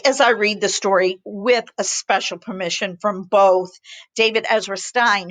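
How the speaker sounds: usual speaking voice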